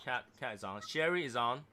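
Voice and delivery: ordinary delivery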